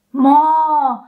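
loud, exasperated mo; frustrated mo. Shown as a demonstration. Teacher demonstrates a frustrated mo. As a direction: let it out in exasperation. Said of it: "come on".

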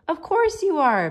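high, loud, emphatic protest; loving mother cries out to reassure the boy; exclaimed. Acted. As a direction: burst out to reassure him. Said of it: "Penny exclaimed".

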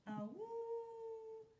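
high sung dog howl. Acted. Dog howling along to her friend's song, high sung howl. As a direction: throw your head back and howl the note. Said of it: "she howled".

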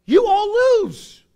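loud, high, drawn-out jeer; mock-triumphant shout. Preacher teasing congregation about losing, shouted. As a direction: holler it like a gleeful verdict.

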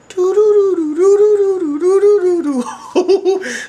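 long high-pitched cackle; loud squealing giggle fit; high shrieking laugh. Real, not acted. Cracks up at friend's email.

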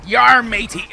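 loud, high playful pirate call; jokey pirate voice; mock pirate. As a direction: holler like a hammed-up pirate. Joking pirate talk after pirate sighting.

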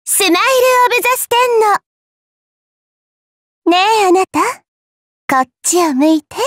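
normal talking voice

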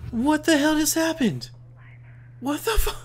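high-pitched shocked outburst; stunned disbelief. Real, not acted. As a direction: squeak it out, baffled by a sudden death. Suddenly sniped.

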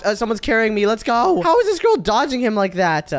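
usual speaking voice

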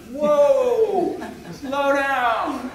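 loud, high, drawn-out halt; mock alarm. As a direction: laughingly call out to rein them in. Acts out reining in eager millennials.

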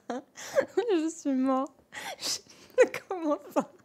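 very slow, drawn-out words through laughter; helpless laughing. Real, not acted. Streamer floored by a viewer's name change, helpless laughing. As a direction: wail it out, cracking up.

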